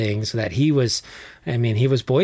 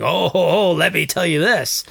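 mock eager "oh oh oh", itching to reply; hammed-up impatient retort; eager comeback; 4 semitones higher than usual. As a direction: jump in like you can't wait to argue back. Eager retort in online argument.

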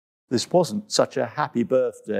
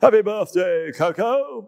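bellowed; 4 semitones higher than usual. Acted. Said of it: "bellowed Tembo".

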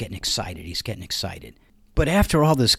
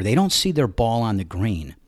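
ordinary delivery

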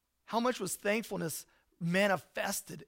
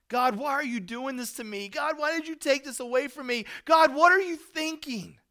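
pleading cry rising higher and louder line by line; exasperated plea to God; 8 semitones higher, 10 dB louder than usual. Retold or imitated. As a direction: throw the blame at God, building to a shout.

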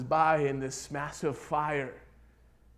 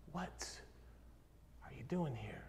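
hushed whisper; 14 dB quieter than usual. Acted. God asks Elijah by name in the cave, hushed whisper. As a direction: drop to a near-whisper, pause after "What". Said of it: "He's beginning to whisper your name".

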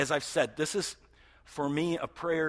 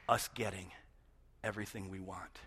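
usual speaking voice

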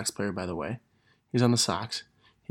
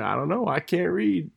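retold a bit higher, small-kid voice, lightly played; quoting the cute kid once more, a hint of child voice; little girl voice; 7 semitones higher than usual. Repeats little girl's quote.

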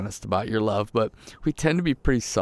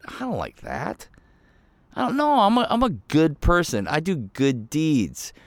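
mock objector; 5 semitones higher than usual. Imitating objecting listener.

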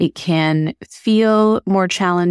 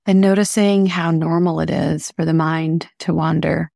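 calm, even meditation voice with long pauses; unhurried guiding, leaving space between phrases; guided meditation. Acted. Host guiding listeners through breathing, calm meditation voice.

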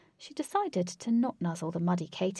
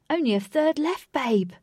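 alarmed; 8 semitones higher, 7 dB louder than usual. Acted. Lynx alarmed.

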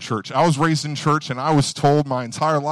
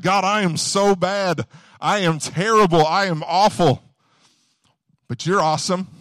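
grovel before God, hammed up; mock groveling prayer; 6 semitones higher, 4 dB louder than usual. Preacher mimicking churchgoers who run themselves down, pleading. Mocking self-abasing prayer.

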